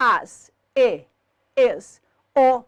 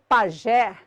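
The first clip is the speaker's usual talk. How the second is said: "pajé" with weight on the last syllable; final-syllable stress; 3 semitones lower than usual. Shown as a demonstration. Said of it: "palavrinhas cuja sílaba tônica é a última".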